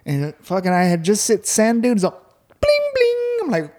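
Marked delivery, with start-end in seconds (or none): 2.6-3.4 high, sung "bling, bling" like a chime; mock doorbell ding-dong; doorbell imitation.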